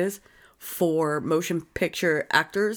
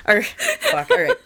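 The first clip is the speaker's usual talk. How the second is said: laughing; 4 dB louder than usual. Real, not acted. Breaks off, laughing.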